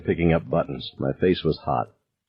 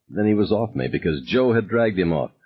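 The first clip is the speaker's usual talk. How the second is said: plain reading voice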